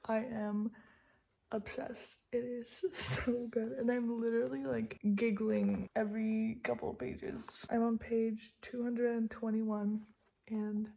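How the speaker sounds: talking with a retainer in; wearing a retainer. Real, not acted. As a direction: retainer in, slightly muffled speech. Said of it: "I literally have my retainer in too".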